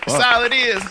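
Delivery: catchphrase jumps up high. Acted. Repeated catchphrase, pitched up.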